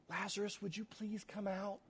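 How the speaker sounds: hushed, too-polite plea; timid polite request. Shown as a demonstration. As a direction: ask him out politely, almost under your breath. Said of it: "this is not a whisper".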